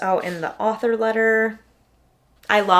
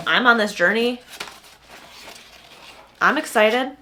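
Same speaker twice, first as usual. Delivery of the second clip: usual speaking voice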